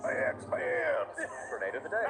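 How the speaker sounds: mock Texas drawl on the segment title; hammed-up Texas twang, Max parody; Texas accent. Co-caster mimics the segment host in Texas accent. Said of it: "your best Texas accent I've heard yet".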